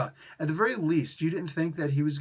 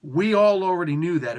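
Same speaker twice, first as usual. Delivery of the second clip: louder, fed-up exclamation; exasperated outburst; 4 semitones higher, 7 dB louder than usual. Real, not acted. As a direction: vent at the writer. Ranting at the comic.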